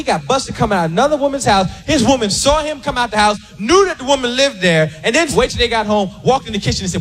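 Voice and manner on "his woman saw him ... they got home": ordinary talk, nothing marked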